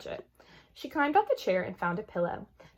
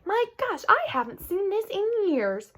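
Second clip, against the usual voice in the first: amazed father; 12 semitones higher, 4 dB louder than usual. A narrator's reading.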